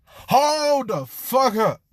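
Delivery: high, loud, drawn-out yell; alarmed shout. Acted. Izuku stopping Jean's misunderstanding, long alarmed shout. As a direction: stretch it into a loud halt.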